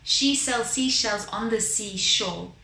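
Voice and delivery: slow, careful tongue twister; really slow. Shown as a demonstration. Singing teacher demonstrates a diction exercise, really slowly. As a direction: speak each word slowly and clearly. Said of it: "So go really slowly".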